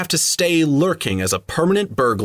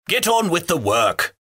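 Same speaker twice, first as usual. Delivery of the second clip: growled; 6 semitones higher than usual. A narrator's reading. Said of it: "growled the butler".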